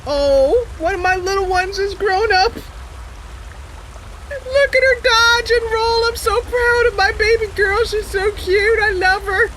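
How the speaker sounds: high, doting proud-mother gush; proud mentor. Retold or imitated. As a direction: gush over her like a proud parent. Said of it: "She's like".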